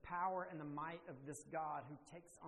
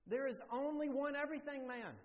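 high, louder proclamation on only; preaching emphasis; 9 semitones higher, 6 dB louder than usual. Real, not acted. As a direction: proclaim it to the whole room. Preacher drives home the point.

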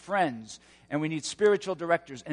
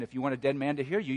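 ordinary talk

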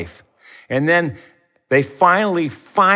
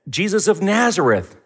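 incredulous; 7 semitones higher, 4 dB louder than usual. Retold or imitated. Disciples mock surprise.

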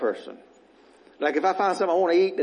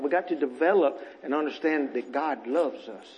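normal talking voice

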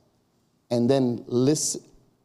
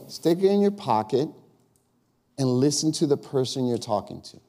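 ordinary delivery

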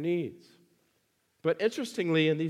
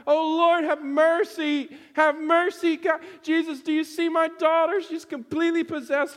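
loud, very high desperate pleading; crying out; 12 semitones higher, 5 dB louder than usual. Retold or imitated. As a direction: act out a frantic mother begging for help. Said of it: "she's just crying out".

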